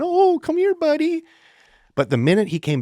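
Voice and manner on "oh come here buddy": put-on high, loud gushing over a child; mock fussing. Adult gushing over a child who held his breath, mock fussing. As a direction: overdo the panicked coddling in falsetto. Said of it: "no one's freaking out over him".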